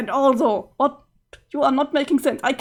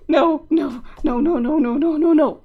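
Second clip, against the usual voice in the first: panicked no; 3 semitones higher, 4 dB louder, slower than usual. Acted. Panicked refusal.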